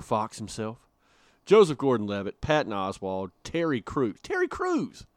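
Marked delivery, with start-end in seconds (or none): 4.2-5.1 high-pitched excited exclamation; excited emphasis. Real, not acted. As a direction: say the name again, amazed and high. Repeats name in amazed disbelief.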